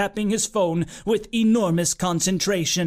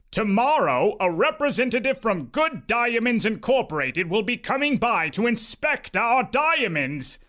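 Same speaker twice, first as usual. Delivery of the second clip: proud uncle; 3 semitones higher than usual. A narrator's reading. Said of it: "proud to make his big announcement".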